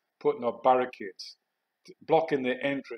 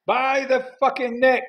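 high, loud, slow-paced yell; taunting shout; 13 semitones higher, 9 dB louder than usual. Retold or imitated. Soldier turning on the cheering rioters, taunting shout. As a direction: bellow it word by word at a crowd. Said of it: "Then I shouted".

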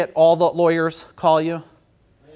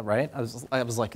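usual speaking voice

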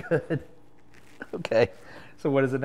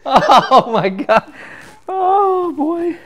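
slow, high, loud laughing words; wheezing through laughter; 12 dB louder, much slower than usual. Real, not acted. Cracking up after pun.

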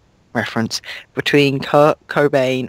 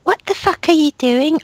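much higher, fast, mock-furious yell; hammed-up angry shout; faster than usual. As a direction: overact the rage for laughs. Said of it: "I shooted Angrily".

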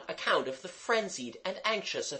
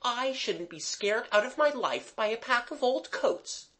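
put-on high-pitched girl's comeback; retorting girl; 10 semitones higher than usual. A narrator's reading. Mabel answering Jimmy's insult, retorting. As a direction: retort in a much higher voice. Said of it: "Mabel retorted".

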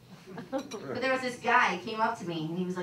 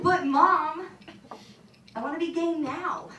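whiny plea to mom; 7 semitones higher, 6 dB louder, slower than usual. Retold or imitated. Whining to mom in retold scene.

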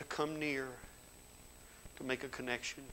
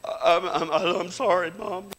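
sobbing apology; 5 semitones higher, 12 dB louder, slower than usual. Retold or imitated. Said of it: "that time it was".

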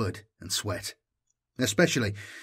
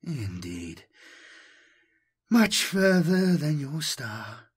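caressing voice; 5 semitones higher, slower than usual. Acted. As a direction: coax him gently, almost cooing. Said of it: "The voice seemed to sigh".

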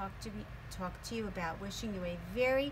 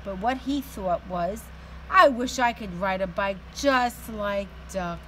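lively, louder storybook voice; teacher reading a picture book aloud to her class; reading to children; 9 dB louder than usual. A narrator's reading. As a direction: read it out with energy for little kids.

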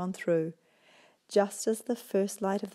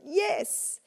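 exuberant yes; 12 semitones higher than usual. Acted. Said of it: "The pigs chimed an exuberant".

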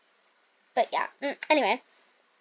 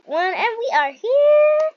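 much higher, loud cheer; excited arrival; 9 semitones higher, 7 dB louder, slower than usual. Real, not acted. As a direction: burst out with joy on landing. Plane lands, she cheers.

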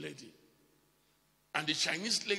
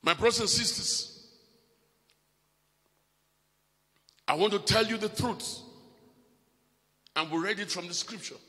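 rising, loud sermon voice; preaching; 6 dB louder than usual. Acted. Preacher exhorting the congregation, loud and rising. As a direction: preach it out to the church.

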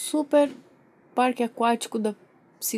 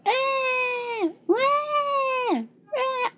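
long high mock wails; baby crying; 12 semitones higher, much slower than usual. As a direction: bawl over and over like a baby. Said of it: "o bebê não para de chorar". (choro insistente de bebê)

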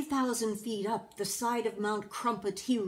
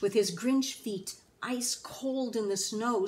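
usual speaking voice

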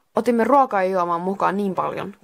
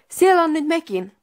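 high, louder astonished exclamation; stunned, voice jumping up; shocked; 10 semitones higher, 5 dB louder than usual. Acted. Santeri sees the doubles of the group, shocked. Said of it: "Santeri sanoi järkyttyneenä".

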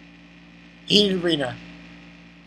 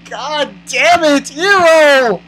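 worked-up fan berating the author; exasperated rant; 8 semitones higher than usual. Real, not acted. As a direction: whine in outrage, stretching the last words.

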